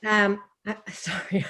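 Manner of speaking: breathing into cold hands; warming freezing hands with breath; blowing on cold hands. Real, not acted. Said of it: "I'm freezing".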